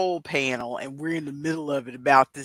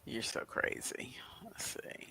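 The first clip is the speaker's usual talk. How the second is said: whisper; 2 semitones lower, 17 dB quieter than usual. Real, not acted. Teasing back at ASMR voice.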